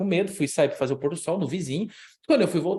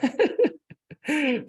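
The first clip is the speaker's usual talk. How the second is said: emotional laugh; 12 semitones higher, 3 dB louder than usual. Real, not acted. Door opens, emotional.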